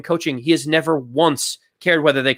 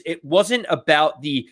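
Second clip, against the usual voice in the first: normal talking voice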